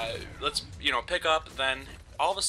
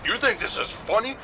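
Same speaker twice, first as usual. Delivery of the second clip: higher, louder stern repeat; angry officer; 9 semitones higher, 4 dB louder than usual. Retold or imitated. Police officer repeating it at the laughing boys, higher and angrier. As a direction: bark the question back harder, pitched high.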